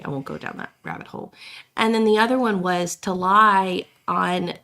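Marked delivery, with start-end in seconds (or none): 0.1-1.3 quick, whispered self-stop; whispered aside. Real, not acted. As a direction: drop to a hush and move on. Cutting herself off, aside.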